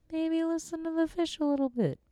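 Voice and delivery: high squeaky fish telling you to listen; little fish voice. Pretend. Fish giving listeners advice in a high squeaky voice.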